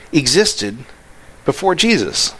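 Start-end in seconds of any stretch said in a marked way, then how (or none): none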